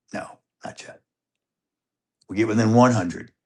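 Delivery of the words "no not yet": hushed no. Retold or imitated. Sneaking up on hogs.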